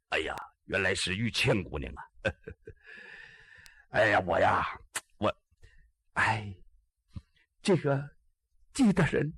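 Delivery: a lightly voiced shy girl stammering; girl Bai Yuqian. Girl apologizing to Ji Xiaolan, in a faltering voice.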